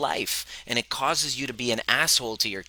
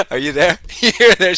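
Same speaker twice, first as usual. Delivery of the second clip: laughing, louder, calling out; 8 semitones higher, 11 dB louder than usual. Real, not acted. Calling laughingly to his wife off camera. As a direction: laugh while calling to her.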